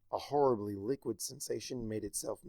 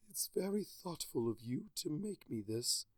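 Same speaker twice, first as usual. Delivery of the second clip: softer, resigned words on a sigh; 4 semitones higher, 7 dB quieter than usual. A narrator's reading. Celestia thanking Twilight for the awful tea, resigned sigh. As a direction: give up politely, sighing it out. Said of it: "she sighed".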